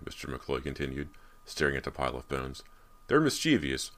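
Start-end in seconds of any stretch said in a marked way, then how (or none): 3.1-3.9 higher, playful lift on mischievous; a light playful tone; lightly playful. A narrator's reading. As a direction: tease the word mischievous. Father explaining ghosts.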